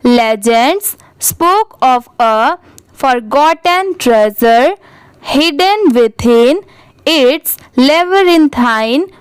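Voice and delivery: very slow, word-by-word reading; teacher demonstrating slow reading aloud; careful model reading. Shown as a demonstration. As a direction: read it out slowly for learners, pausing between words. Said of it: "पूरे paragraph को एक बार अच्छे से read".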